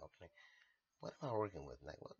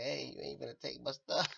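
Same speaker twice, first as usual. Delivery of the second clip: mock protest; 8 semitones higher, 12 dB louder than usual. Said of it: "first I'm like".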